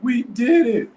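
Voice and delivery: high, loud mock victory shout; imitated celebratory yell. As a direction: yell it like an overjoyed young coach. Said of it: "just start yelling".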